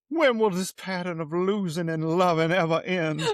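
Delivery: a mock dramatic Steve voice, half laughing, half crying; imitating Steve. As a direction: ham up his tearful line while laughing.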